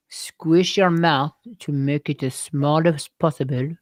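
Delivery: exaggerated French accent, small tight mouth. Shown as a demonstration. Teaching a good French accent, French accent. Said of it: "how to do a good French".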